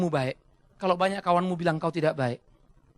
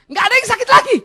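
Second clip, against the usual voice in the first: loud, high exclamation; cured patient crying out in amazement; hammed-up delighted shout; excited relief; 13 dB louder, faster than usual.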